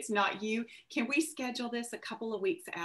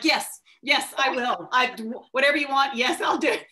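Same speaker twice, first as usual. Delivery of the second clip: louder, rushed, gushing acceptance; eager yes; 4 semitones higher, 9 dB louder than usual. Retold or imitated. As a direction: jump at the offer, loud and eager. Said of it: "And I was like".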